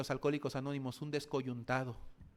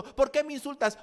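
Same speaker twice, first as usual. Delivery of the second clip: offended half-dead man; 11 semitones higher, 9 dB louder than usual. Acted.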